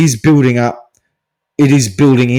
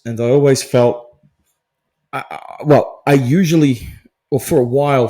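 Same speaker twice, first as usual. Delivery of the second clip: usual speaking voice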